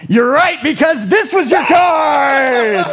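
loud, slow triumphant reveal; triumphant shout. Pretend. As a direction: cry it out like a showman.

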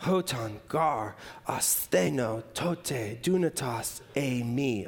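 slow, careful Koine Greek, "Hotan gar astheno tote dunatos eimi"; Greek reading. Shown as a demonstration. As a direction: read the Greek out slowly and low. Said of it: "This is how it reads".

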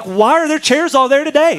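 high, loud mock disbelief; hammed-up baffled newcomer; shrill-free but loud astonished question; incredulous. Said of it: "And we're like".

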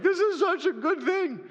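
high, wobbling tearful wail; hammed-up happy crying; mock weeping. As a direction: blubber it, poking fun at yourself. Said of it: "I was just weeping on the desk".

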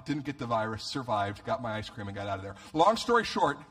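usual speaking voice

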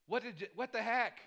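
acted-out baffled protest, voice jumping up; exasperated disbelief. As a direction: throw it out in exasperated bewilderment. Acting out questioning God.

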